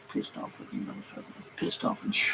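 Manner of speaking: breathy whispered muttering; whispered grumble. Real, not acted. Mechanic fuming at engineers, whispered grumble.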